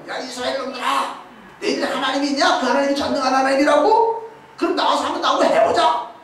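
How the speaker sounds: 높고 크게, 이스라엘을 조롱하는 거인의 외침; mocking taunt. Goliath taunts Israel. (골리앗이 이스라엘 군대에게 비웃으며 소리친다)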